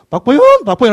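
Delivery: high, loud mock call of a name. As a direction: holler the name like a cheeky kid. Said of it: "하고 제대로 했겠어요". (아이가 어른 이름을 크게 부르는 장난스러운 흉내)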